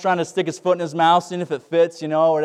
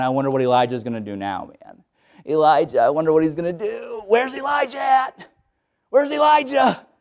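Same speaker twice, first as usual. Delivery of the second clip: curious townspeople. Acted. People wondering about Elijah.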